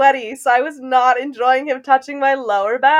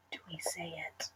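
barely audible whisper; 6 semitones lower, 28 dB quieter than usual. Real, not acted. Speaker checks with friend before revealing gossip, whispering. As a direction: whisper it like a secret.

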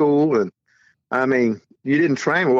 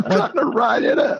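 higher, laughing through the words; can barely get it out for laughing; 9 semitones higher than usual. Real, not acted.

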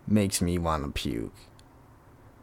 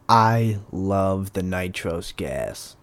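usual speaking voice

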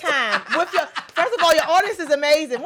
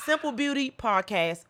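normal talking voice

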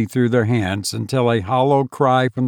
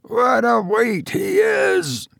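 high, slow, strained under a heavy load; panting with effort; 12 semitones higher, slower than usual. A narrator's reading. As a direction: gasp it out while hauling. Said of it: "panted Bob".

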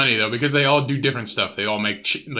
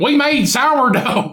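much higher, slow, laughing; mock smug hippie; 10 semitones higher, 4 dB louder, much slower than usual. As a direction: drawl it with smug pride.

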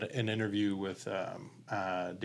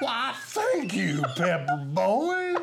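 laughing imitation; 10 semitones higher, 6 dB louder, much slower than usual. Repeats the Farley line, laughing.

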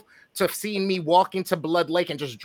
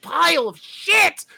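high, slow disgusted outburst; retold angry rant; 10 semitones higher, slower than usual. Still ranting to his girlfriend about the shoot, high slow disgust. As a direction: drag it out in pure disgust. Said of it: "I'm like".

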